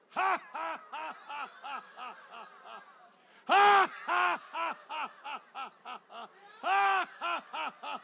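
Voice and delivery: loud bursts of ha ha fading down; hearty ha ha, each burst fading; forced laughter. Shown as a demonstration. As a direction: lead a laughter yoga round. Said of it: "One more time".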